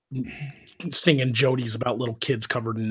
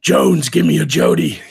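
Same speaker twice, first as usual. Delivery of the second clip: mock drill-instructor command, loud; gunny's order; 10 dB louder than usual. Retelling Clint Eastwood ordering a marching Marine, gunny's order.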